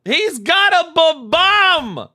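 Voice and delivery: high, loud, slowed astonished outburst; excited disbelief. Real, not acted. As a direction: exclaim it at the screen. Spots the bomb in replay.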